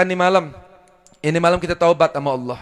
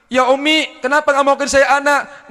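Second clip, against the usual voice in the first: put-on pleading child; 7 semitones higher, 3 dB louder than usual. Child and mother arguing, retold.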